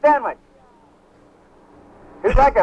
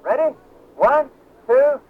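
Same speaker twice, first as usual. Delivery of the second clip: ordinary talk, nothing marked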